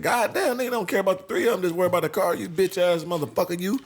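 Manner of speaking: high, fast angry rant; retold angry rant. Telling off the people who only asked about the car, high and fast. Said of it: "I said".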